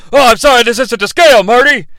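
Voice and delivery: loud, rapid excitable inventor impression; Doc Brown. Inventor apologizes for his model, frantic. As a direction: rush it like a frantic movie scientist. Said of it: "a full Doc Brown".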